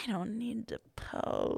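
quiet, slow, half-whispered resolve; soft, slow self-talk. Retold or imitated. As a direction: murmur it slowly to yourself. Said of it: "where I'm like".

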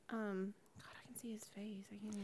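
hushed straining whisper to self. Real, not acted. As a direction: whisper it while trying to remember. Straining to recall a name.

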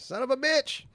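high, hammed-up impatient whine; mock impatience. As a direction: whine way up high like a kid who can't wait. Impatient for Red Dead release.

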